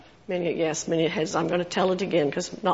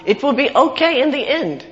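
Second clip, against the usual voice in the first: quoting the film character; 4 semitones higher than usual. Retold or imitated. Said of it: "he says the line".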